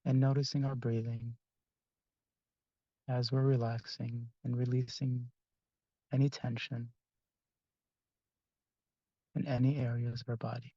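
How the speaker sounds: soft, unhurried meditation voice with long pauses; guided meditation. Acted. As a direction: gently guide the group into relaxation, phrase by phrase.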